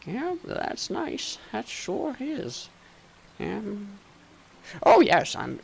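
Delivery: slow, high, soft absent-minded mumble; shopkeeper muttering to himself. Acted. Shopkeeper circling his shop looking at everything, muttering to himself. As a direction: potter about talking to yourself. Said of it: "he's, like, muttering to himself".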